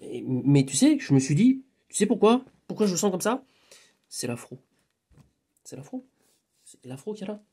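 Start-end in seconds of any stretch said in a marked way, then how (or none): 4.1-7.4 dropped almost to a whisper; hushed aside. Real, not acted. Telling a friend under the breath, hushed. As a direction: lean in and murmur it like a secret.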